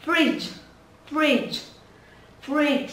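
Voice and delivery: short "Fridge" with the final dge released; bật âm cuối. Shown as a demonstration. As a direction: pop the ending of "Fridge". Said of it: "quan trọng là chúng ta phải có âm cuối". (đọc "Fridge" nhanh, bật âm cuối)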